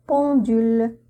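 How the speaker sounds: ordinary talk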